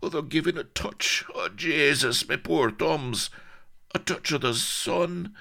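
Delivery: high, pained whimper; strained, agonised small talk. A narrator's reading. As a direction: break off to moan in pain. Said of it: "whimpered the Colonel".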